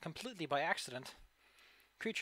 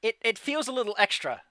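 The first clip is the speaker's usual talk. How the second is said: amused laughing; 7 semitones higher, 7 dB louder than usual. Real, not acted.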